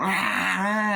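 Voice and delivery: high, drawn-out mock crying; imitated baby wail. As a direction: wail like a newborn. Babies start crying, imitated.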